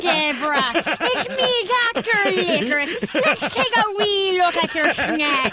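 high, lilting put-on Irish accent; Doctor Licorice. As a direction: greet her as a hammed-up Irish doctor. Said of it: "hear an Irish accent".

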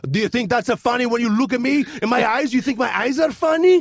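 security guard scolding a shoplifter, lightly hammed-up anger; mock angry security guard. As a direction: imitate an offended guard's outburst. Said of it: "as he was yelling at me".

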